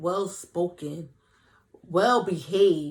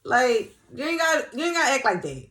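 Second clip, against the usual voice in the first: high, loud teasing "relax" to a confident man; mock-scold him to tone it down; hyped-up admiring tease; playful scolding; 10 semitones higher, 6 dB louder than usual. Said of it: "I be like".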